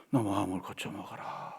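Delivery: hushed, unvoiced divine rebuke; whispered command. Preacher voicing God whispering a rebuke to the grumbler. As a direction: breathe the order as a secret.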